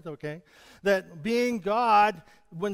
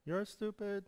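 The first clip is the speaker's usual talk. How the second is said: much softer repeat, like an echo; 10 dB quieter than usual. Shown as a demonstration. Preacher shows how insults echo back softly. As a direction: let it fade back like an echo. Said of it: "what you send out".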